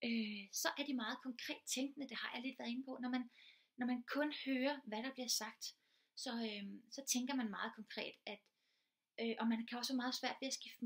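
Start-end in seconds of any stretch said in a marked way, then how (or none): none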